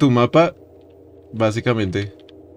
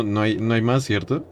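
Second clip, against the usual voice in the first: ordinary talk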